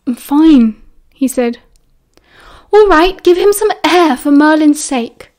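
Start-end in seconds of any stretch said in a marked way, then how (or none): 0.1-0.8 loud, curt annoyed reply; irritated. A narrator's reading. 2.7-5.3 high, loud outburst; bursting out. A narrator's reading. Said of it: "suddenly burst out".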